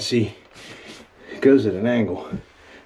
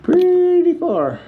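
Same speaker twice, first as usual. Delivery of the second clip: 1 straining; 5 dB louder, much slower than usual. Real, not acted. Straining to see down the hole.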